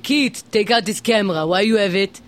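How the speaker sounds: imitation Israeli official's broken English; mock Israeli accent. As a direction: put on a thick Israeli accent. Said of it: "Does he sound like this".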